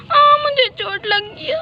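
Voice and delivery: high, slow wail of pain; mock pained wail; crying out hurt. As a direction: cry like a hurt little kid.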